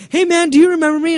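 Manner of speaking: high, quick, eager mimicry of a fan; squeaky pushy fan asking to be remembered; lightly hammed-up eager fan; mock fanboy voice. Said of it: "I like how he's like".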